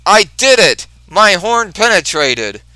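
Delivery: high, loud gleeful boast; loud high triumphant shout; triumphant cry. Retold or imitated. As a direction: crow with victory. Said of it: "Rhino says".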